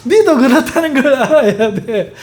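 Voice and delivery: high, laughing tease at his wife; husband laughing off his wife's gossip; teasing husband. Retold or imitated.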